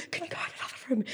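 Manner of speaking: hushed whispered request. Retold or imitated. Mother whispers to son with friends round. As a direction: whisper it so guests won't hear. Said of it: "And I'd go to him".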